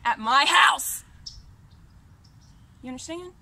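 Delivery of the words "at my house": loud, real angry shout; furious shout. Real, not acted. Scolding the friend who came to his house, shouting. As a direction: yell it out at full force. Ranting at uninvited visitor.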